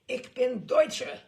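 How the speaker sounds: slow, loud pronouncement; declaring. A narrator's reading. Narrator declares himself German, slow and loud. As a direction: announce it proudly.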